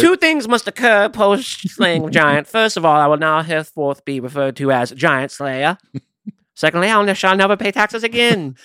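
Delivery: high, slow, hammed-up British knight accent; pompous medieval British knight. Giant slayer announcing his terms in a slow pompous medieval British accent. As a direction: lay down terms like a grand knight. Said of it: "from medieval British".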